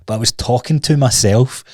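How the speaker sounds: ordinary delivery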